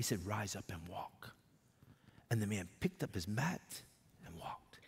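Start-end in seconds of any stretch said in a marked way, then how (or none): 0.3-1.4 whispered, soft and low; hushed command. Retold or imitated. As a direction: breathe the healing words gently. Jesus healing the paralytic.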